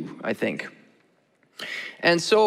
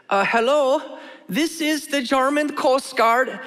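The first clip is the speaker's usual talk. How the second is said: put-on hesitant, high-pitched German-accented rookie; German coast guard on his first day; 8 semitones higher, slower than usual. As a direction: do an amateurish German accent, apprehensive. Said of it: "I have to do the accent".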